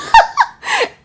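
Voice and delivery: loud, high laugh; real high-pitched cackle of amusement; burst of laughter. Real, not acted. Laughs at inattentive partner.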